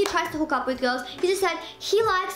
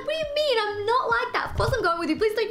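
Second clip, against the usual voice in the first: high, mock-indignant reply; acted protest; 6 semitones higher, faster than usual. Woman answers her friend's question, mildly indignant protest. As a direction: protest it in a raised pitch.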